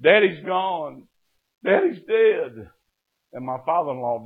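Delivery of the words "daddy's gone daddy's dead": high, drawn-out acted wail; imitated tearful, slow lament; crying. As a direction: cry out the news as a grieving daughter. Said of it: "Penny was crying, and she said".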